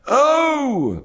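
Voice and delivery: high howled oh; frantic yell. A narrator's reading. Old dealer yelling a line of his song, frantic. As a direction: cry it out like a madman's song. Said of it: "yelling in a frantic way".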